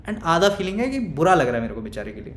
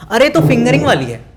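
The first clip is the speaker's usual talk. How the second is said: loud, high-pitched exclamation as she jumps up; sudden loud outburst; loud blurt; 7 semitones higher, 11 dB louder than usual. Retold or imitated. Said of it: "ये ऐसे बोल के ऐसे खड़ी हुई".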